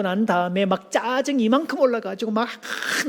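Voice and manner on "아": normal talking voice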